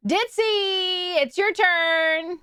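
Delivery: high, drawn-out sing-song call. Pretend. Streamer fetching Ditzy for her turn, calling out. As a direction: summon someone with long stretched words.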